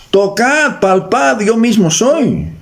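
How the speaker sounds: high, ringing declaration, slowing at the end; proclaimed invitation. Acted. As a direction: proclaim it high and stretch the close. Quotes risen Jesus addressing his disciples.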